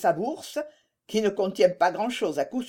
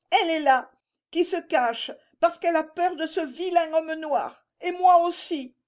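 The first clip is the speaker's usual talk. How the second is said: put-on much higher, childlike voice; small child's voice; 10 semitones higher than usual. A narrator's reading. Little Pierre telling his father about the scary man, child's voice. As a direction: voice a scared little boy, very high.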